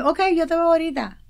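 high, loud and hurried eagerness; eager reply. Retold or imitated. Mother promising her son she'll come, high eager voice. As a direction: promise it with bright urgency.

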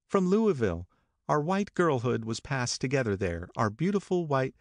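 high, wistful Daisy; Daisy recalling her girlhood to Nick, high; Daisy reminiscing. A narrator's reading.

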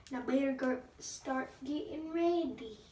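ordinary delivery

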